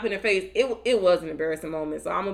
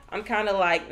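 normal talking voice

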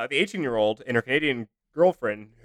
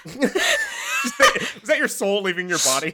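laughing; 6 semitones higher, much slower than usual. Real, not acted. Laughing at co-host.

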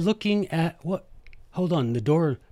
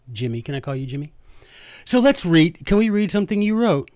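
ordinary talk, nothing marked

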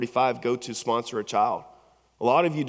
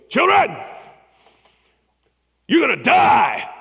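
impersonating a fiery preacher; 10 semitones higher, 8 dB louder than usual. Retold or imitated. Pastor warning graduating kids they will die, shouting. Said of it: "and he goes".

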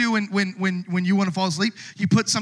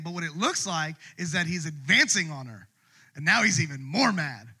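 normal talking voice

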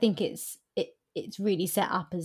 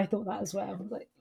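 normal talking voice

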